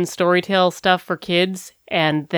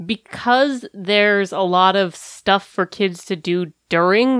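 ordinary talk

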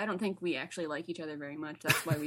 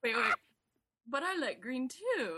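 imitating Wiseau's accent; 6 semitones higher than usual. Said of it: "I can't do the accent very well".

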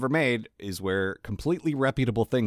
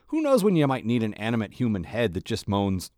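ordinary talk, nothing marked

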